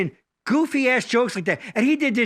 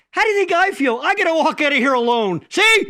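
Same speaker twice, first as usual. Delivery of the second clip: higher, louder deadpan punchline; punchline shout; 6 semitones higher, 4 dB louder than usual. Retold or imitated. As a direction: throw the punchline up and out. Man voiced in joke punchline.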